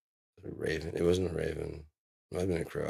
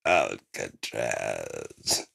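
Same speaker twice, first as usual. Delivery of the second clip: much lower, very slow, louder "Alcatraz"; dramatic drawn-out name; 9 semitones lower, 6 dB louder, much slower than usual. Retold or imitated. Quoting a favorite comedy, "Alcatraz" very slow, much lower. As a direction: stretch "Alcatraz" deep and ominous.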